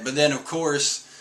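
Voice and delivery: ordinary delivery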